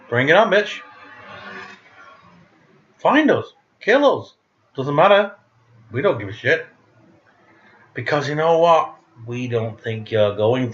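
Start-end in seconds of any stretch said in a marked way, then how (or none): 0.1-6.7 defiant taunt. Retold or imitated. Daring the online haters, defiant. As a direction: taunt them to bring it on. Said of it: "we would be like".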